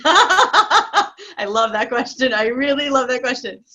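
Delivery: laughing, slowed and delighted; laughing excitement. Real, not acted. Coach still giggling with delight at the question. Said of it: "I'm getting too excited".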